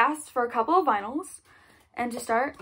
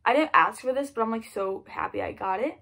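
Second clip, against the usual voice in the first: normal talking voice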